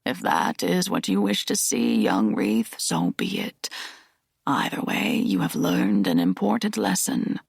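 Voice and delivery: put-on old Aslan.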